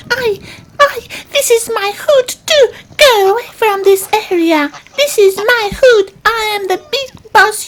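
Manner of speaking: pushy little squirrel, voice cracking; whiny cartoon squirrel. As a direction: squeal your threats like a tiny tough guy.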